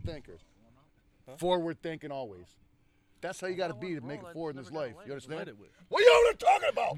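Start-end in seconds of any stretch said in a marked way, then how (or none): 5.9-7.0 high, loud accusing shout; yelling. Real, not acted. Host to co-hosts talking off mic, yelling. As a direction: yell across at the mumbler. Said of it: "I yelled at him".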